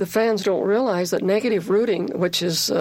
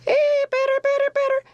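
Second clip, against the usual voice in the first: imitating a very high-pitched heckling chant; high-pitched chatter. Imitating a teammate heckling the batter, very high-pitched chant. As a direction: squeal it as a mimicked infield chant. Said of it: "she has a real high-pitched voice, and she says".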